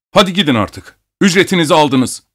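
high, curt send-off; offended, impatient dismissal; angry dismissal. A narrator's reading. Abogin angrily dismisses the doctor.

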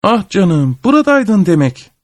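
high chirpy bird voice, far above the narrator's own. A narrator's reading. Kazana gagasıyla tutunan kuş cıvıl cıvıl, ince ve yüksek sesle. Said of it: "Bir kuş gagasıyla tuttu".

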